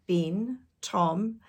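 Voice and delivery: plain reading voice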